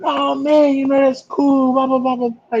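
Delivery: high-pitched gushing chatter; imitating the fan's high voice; excited fan. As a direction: gush like an eager fan.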